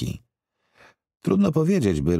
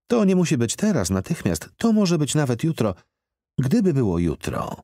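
higher woman's voice, pressing; pushy blonde lady; 9 semitones higher than usual. A narrator's reading. Organizer presses the man to help, in a higher woman's voice. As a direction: coax him insistently.

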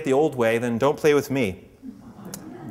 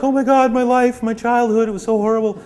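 much higher, louder hammed-up weeping; mock sobbing; 10 semitones higher, 6 dB louder than usual. Pawns hugging in a small group, hammed-up tearful outpouring. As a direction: overdo the tearful confession. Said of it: "they're crying, hugging each other".

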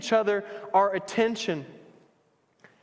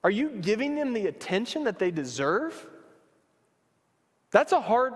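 ordinary talk, nothing marked

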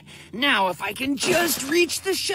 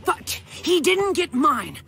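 ordinary delivery

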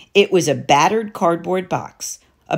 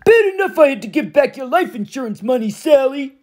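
higher-voiced resentful stepfather growl; growling Gabe; 10 semitones higher than usual. A narrator's reading. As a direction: grumble it like a bully. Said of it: "he growled".

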